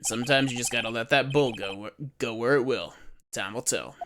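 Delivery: put-on lightly done cowboy Western accent; easygoing Western lawman accent; relaxed Western accent, unhurried; Jake Marshall Western accent. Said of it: "I can do a Western accent decently well".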